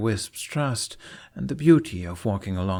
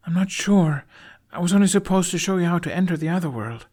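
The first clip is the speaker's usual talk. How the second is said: put-on higher, quick boyish voice; young boy's voice; 9 semitones higher, 5 dB louder, faster than usual. A narrator's reading. Boy explaining he only had to show the way, fast and higher. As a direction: rush it like an unsure boy.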